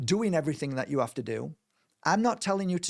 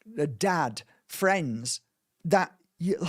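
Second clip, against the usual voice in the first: ordinary talk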